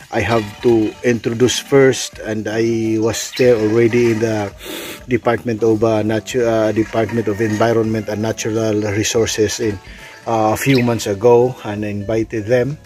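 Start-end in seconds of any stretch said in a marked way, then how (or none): none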